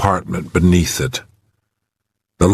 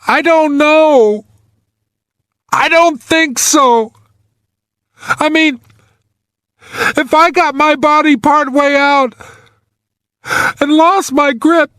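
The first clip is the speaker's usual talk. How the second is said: on the verge of tears. A narrator's reading. Trapped man doubts his grip to rescuer, near tears. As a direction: falter as if about to cry, voice high. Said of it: "He sounded on the verge of tears".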